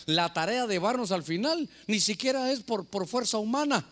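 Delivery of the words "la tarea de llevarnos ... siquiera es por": ordinary talk, nothing marked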